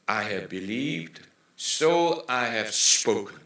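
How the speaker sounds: slow English recitation. Shown as a demonstration. Reads the verse in English.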